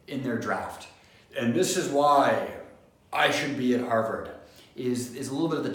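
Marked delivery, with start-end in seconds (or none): 1.4-4.3 arrogant, brash. Acted. Student overselling themselves in a personal statement, arrogant. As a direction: announce it brashly, leaning hard on the words. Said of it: "It's arrogant, it's a little bit brash".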